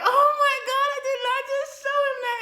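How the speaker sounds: high, loud, rushed wail; hammed-up embarrassed squeal; mock mortified. Patient's mortified outburst at home.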